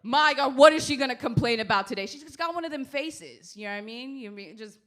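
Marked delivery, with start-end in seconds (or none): 0.0-2.1 loud exasperated groan of the crowd; loud fed-up crowd moaning at the Queen; mock exasperated crowd. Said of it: "everyone would be like".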